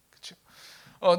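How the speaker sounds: ordinary talk, nothing marked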